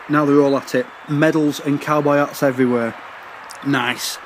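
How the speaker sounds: ordinary delivery, nothing marked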